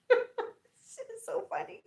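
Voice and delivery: breathy laugh through the words; cracking up mid-sentence. Real, not acted. Mother cracking up retelling daughter's call, laughing.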